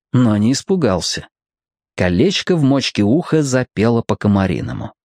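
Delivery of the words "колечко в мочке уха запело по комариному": plain reading voice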